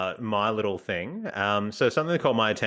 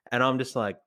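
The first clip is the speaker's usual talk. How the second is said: usual speaking voice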